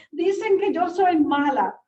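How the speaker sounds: mock child, hurt and insistent; upset little girl. Child voiced in retold story.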